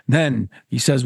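usual speaking voice

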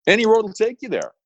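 high-pitched voice for a storybook cat; Cheshire Cat. Acted. Quoting the Cheshire Cat to Alice, high character voice. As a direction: lift the whole line into a high quoted voice. Acting the Cheshire Cat's reply.

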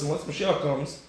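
normal talking voice

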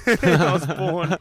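loud, slow, laughing through the words; cracking up mid-story. Real, not acted. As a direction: say it while cracking up.

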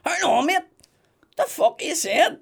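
hammed-up annoyed heckle; mock angry punter. Comic voices an angry audience member, mock shout.

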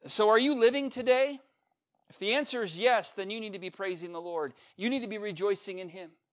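ordinary delivery

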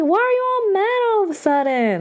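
high-pitched, hammed-up bafflement; mock exasperated whine. Teacher to suddenly angry pupil, mock exasperated whine. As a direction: complain it way up high, in jest.

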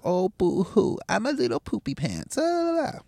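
mocking whiny baby voice, high-pitched; fake crying. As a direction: whine like a crybaby, pitch up. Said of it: "pent up anger".

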